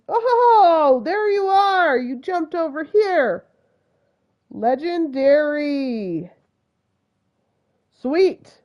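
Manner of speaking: high, loud, drawn-out exclamation; thrilled discovery. Real, not acted. Player spotting the missing legendary, thrilled. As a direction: whoop with delight at finding it.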